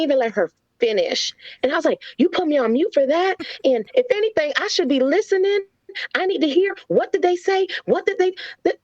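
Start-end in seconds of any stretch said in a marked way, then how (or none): 2.2-8.8 mock indignant scolding, pitched up; upset outburst. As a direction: replay an exasperated mom going off, voice up high. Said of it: "I just went off and I was upset".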